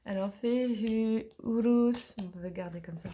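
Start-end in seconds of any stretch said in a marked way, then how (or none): 0.3-2.1 "Fehu, Urus" drawn out slowly, carefully sounded; "Fehu, Urus" as a learner's careful attempt; practised rune pronunciation. Shown as a demonstration. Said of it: "Je vais essayer avec mon accent".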